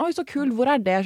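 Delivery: imitating an excited stranger; mock delight. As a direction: gush like an impressed stranger. Mimicking enthusiastic stranger.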